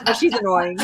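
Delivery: usual speaking voice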